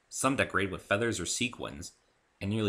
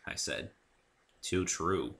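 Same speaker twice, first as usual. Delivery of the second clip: ordinary delivery, nothing marked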